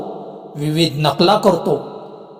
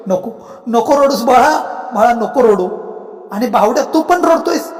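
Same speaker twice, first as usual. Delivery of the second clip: pleading; 5 semitones higher than usual. Acted. Ghost begs crying son unheard.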